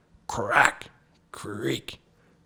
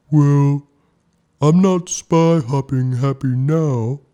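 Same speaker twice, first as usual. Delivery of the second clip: slow, high, louder sobbing whale voice; a big whale, slow and high, on the edge of tears; 7 semitones higher, 6 dB louder, slower than usual. A narrator's reading. Trapped whale tells Snow Bear he's unhappy, sobbing. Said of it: "sobbed the whale".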